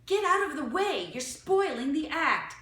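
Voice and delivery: loud, high, haughty command. A narrator's reading. La Gloria ordering the children away, haughty and loud. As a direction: order them off like a diva. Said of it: "she said haughtily".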